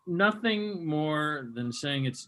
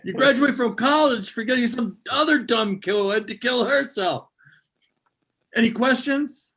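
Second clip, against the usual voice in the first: high, loud mocking rant; hammed-up teacher rant; 8 semitones higher, 7 dB louder than usual.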